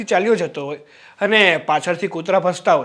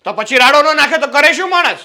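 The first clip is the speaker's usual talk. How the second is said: loud, higher, sarcastic retort; shouting back; 5 semitones higher, 5 dB louder than usual. Acted. Brother-in-law shouting back at his sister-in-law, sarcastic. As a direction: fire it back at her, half laughing. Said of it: "આટલા ઊંચા અવાજે મારી સાથે નહીં બોલો".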